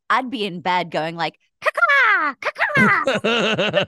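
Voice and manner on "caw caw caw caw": loud, high barking caw; mock bird caw. Loud, high caws to annoy a husband in bed. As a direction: squawk it like a crow. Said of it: "you just growled and barked".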